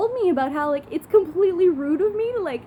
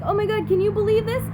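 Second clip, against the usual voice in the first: retold amazement; 6 semitones higher, 4 dB louder than usual. Retelling own amazed reaction.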